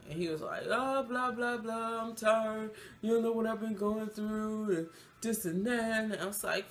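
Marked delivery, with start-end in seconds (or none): 0.7-6.0 higher, singsong mocking of his excuses; mocking whine. Child's father refusing to move the tray, mocked whining. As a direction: rattle off his complaints in a mock whine.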